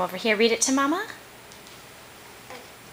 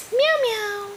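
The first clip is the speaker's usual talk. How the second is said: much higher squeaky cat meows; kitty meow; 12 semitones higher than usual. Retold or imitated. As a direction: meow like a tiny kitten for the baby. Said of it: "Kitty goes".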